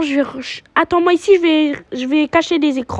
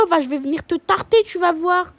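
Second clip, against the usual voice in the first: ordinary talk, nothing marked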